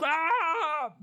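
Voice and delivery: very high strangled squawk; dying bird cry. Pretend. Voicing the bird's last cry, very high squawk. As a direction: let out a tiny dying shriek. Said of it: "That's like".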